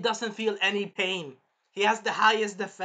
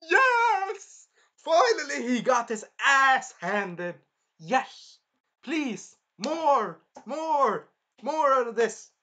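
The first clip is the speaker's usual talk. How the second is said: higher, louder whoops of joy; gleeful cheering; 6 semitones higher, 5 dB louder, much slower than usual. Retold or imitated. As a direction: cheer the beating on like a fan. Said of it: "And I'm like".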